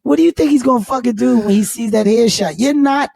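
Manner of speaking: loud, high mock telling-off; retold exasperated rant; exasperated scolding. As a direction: scold your friend in disbelief.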